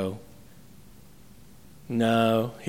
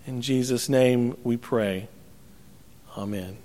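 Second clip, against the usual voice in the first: closing prayer; 2 semitones lower than usual. Acted. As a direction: pray earnestly with the congregation.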